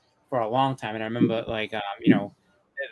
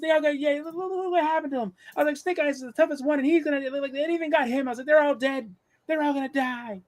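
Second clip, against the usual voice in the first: put-on much higher, upset child insisting; retold kid sure the heroes will die, mock distraught; distraught little kid. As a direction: wail it like a frightened six-year-old. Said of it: "I was distraught".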